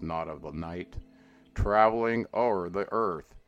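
plain reading voice

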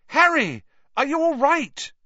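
high, loud and urgent, calling him back; frantic call. Acted. Friend shouting to wake a fainted Harry, frantic call. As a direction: shout his name to wake him, rising in alarm.

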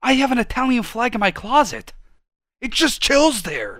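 loud, high-pitched proud declaration; worked-up boasting. Real, not acted. Boasting to friend of his Italian flag, worked up. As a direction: proclaim it at the top of your range.

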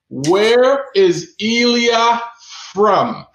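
slow, each word stretched, with a pause before from; slow drawn-out question. Shown as a demonstration. Host repeating the quiz question to the chat, slow and stretched. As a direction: drag out Where is Elia, then land from. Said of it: "quiz host repeats question".

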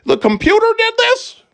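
very high, hammed-up old man voice; baffled old guy. Mocking himself as an old guy amazed by computers, baffled old-man voice. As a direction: play an old guy astonished by technology. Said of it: "Sound like an old guy".

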